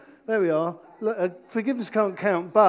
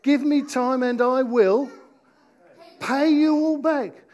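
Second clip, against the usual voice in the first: raised-pitch, urgent plea; pleading; 4 semitones higher, 5 dB louder than usual. Acted. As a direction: plead for more time, voice lifting and slowing at the end. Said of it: "the man pleaded".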